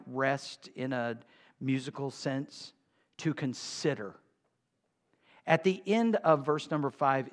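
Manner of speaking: usual speaking voice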